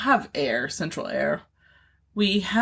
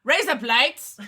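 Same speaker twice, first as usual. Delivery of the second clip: "Razor blades" in a high Australian accent, like "raise up lights"; mock Australian "Razor blades"; 6 semitones higher, slower than usual. Shown as a demonstration. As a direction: say "Razor blades" Aussie style. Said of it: "in an Australian accent".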